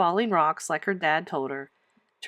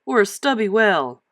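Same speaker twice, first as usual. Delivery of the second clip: curious man voice; 4 dB louder than usual. Acted. Same man asks.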